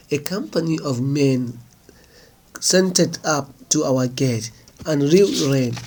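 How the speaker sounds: ordinary delivery, nothing marked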